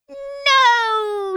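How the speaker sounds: high, loud crying wail. Acted. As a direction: wail it out in despair. Witch wails at the new failure.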